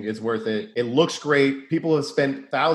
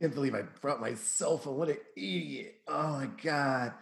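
muttering; 12 dB quieter than usual. Acted. Muttering to self about phone.

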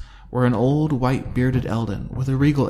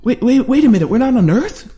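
much higher and louder, tumbling out; alarmed disbelief; 13 semitones higher, 8 dB louder than usual. A narrator's reading. Cubby learns they are not on Earth, alarmed. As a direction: stop everything in shock. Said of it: "Cubby asked".

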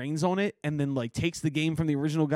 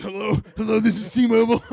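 louder, higher mock telemarketer; Kevin Hart telemarketer bit; 5 semitones higher, 7 dB louder than usual. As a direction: belt it like a huge pushy caller. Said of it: "He's like".

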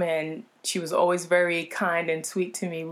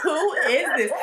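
amused disbelief; 9 semitones higher, 4 dB louder than usual. Retold or imitated. Repeated with more emphasis.

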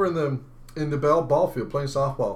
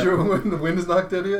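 ordinary delivery

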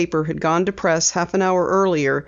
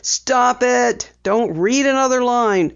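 commanding; 6 semitones higher, slower than usual. A narrator's reading. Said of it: "the editor commanded".

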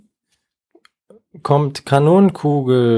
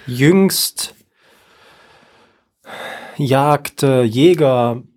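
langsam; much slower than usual. Shown as a demonstration. Slow deliberate words.

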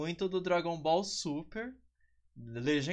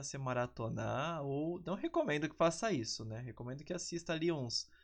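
ordinary delivery

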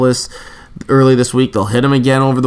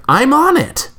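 high mock old lady; mock old church lady; 13 semitones higher than usual. Mocking the ad as an old church lady, high. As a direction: lift "I'm on it" high like a dotty old lady. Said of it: "and the old church lady, it's like".

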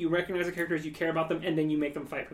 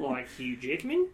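put-on Australian accent; 5 semitones lower, slower than usual. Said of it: "that's how you do an Australian accent".

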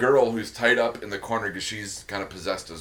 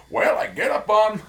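put-on old-timey miner; 11 semitones higher, 5 dB louder than usual. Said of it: "quoting miner bit".

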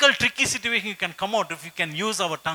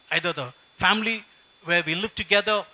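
usual speaking voice

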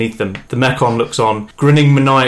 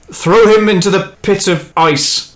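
intoning; 7 semitones higher, 4 dB louder than usual. Acted. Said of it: "intones Ming".

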